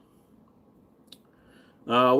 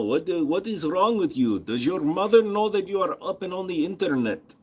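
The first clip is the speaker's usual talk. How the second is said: higher-pitched put-on Russian accent; Soviet-bloc accent; 4 semitones higher than usual. Scolding a livestream troll, mock Russian accent. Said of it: "It's more of a Soviet-style bloc kind".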